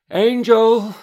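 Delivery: very loud, high-pitched calling out; shouting. Acted. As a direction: call the name at full voice, drawn out. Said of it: "She was shouting".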